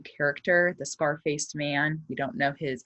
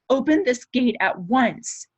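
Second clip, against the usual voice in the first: aunt's sharp order, higher and louder; commanding shout; 9 semitones higher, 6 dB louder than usual. Acted. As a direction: order the steward to open the gate. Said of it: "Aunt Daisy shouted in a tone".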